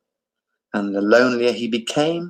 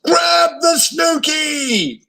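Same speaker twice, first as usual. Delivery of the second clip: urgent cry; much slower than usual. A narrator's reading. Gordon calling to drowning Abu, very high and urgent. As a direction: call across the water to Abu. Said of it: "cried Gordon".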